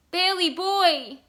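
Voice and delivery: high, loud, drawn-out cry; tragic call. A narrator's reading. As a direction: call out with grief. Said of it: "called in a tragic voice".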